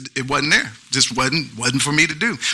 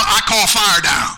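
proud slogan. Retold or imitated. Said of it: "I'm a".

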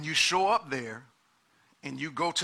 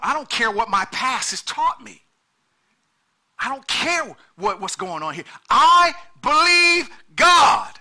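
preaching at full force; 7 semitones higher, 11 dB louder than usual. Acted. Pastor voicing a believer's defiant faith, rising preaching shout.

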